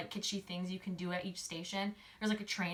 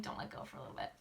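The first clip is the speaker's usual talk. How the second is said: teasing hush; 8 semitones lower, 10 dB quieter, faster than usual. Real, not acted. Narrating the boys holding hands, sly hushed aside. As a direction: drop to a sly near-whisper.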